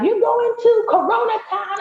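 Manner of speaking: high, mock-incredulous friends' question; incredulous friends. Friends asking about her travel plans, incredulous. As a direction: pitch it high, as friends in disbelief.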